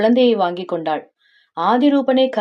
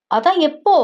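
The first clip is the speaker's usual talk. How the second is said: high, pestering question; insistent questioning; 7 semitones higher than usual. Acted. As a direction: press for an answer, pitch lifted. Wife keeps pressing husband. (விடாமல் உயர்ந்த குரலில் கேட்பது)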